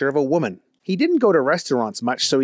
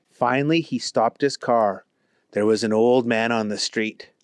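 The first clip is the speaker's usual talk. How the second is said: ordinary delivery, nothing marked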